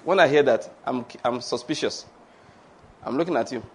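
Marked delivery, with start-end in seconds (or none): none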